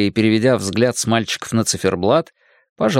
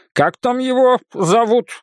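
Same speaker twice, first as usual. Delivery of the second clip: much higher-pitched threatening demand; menacing; 12 semitones higher than usual. A narrator's reading. Librarian demanding the friend's name, menacing. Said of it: "грозно спросил он".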